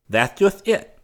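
high, nervous hesitant voice; hesitant and eager to please; hesitant fop. A narrator's reading. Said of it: "replied the fop hesitantly".

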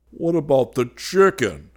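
loud, high anguished groan. A narrator's reading. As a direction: wail it in comic despair. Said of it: "groaned Fallowby".

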